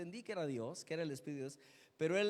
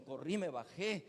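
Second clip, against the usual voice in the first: ordinary talk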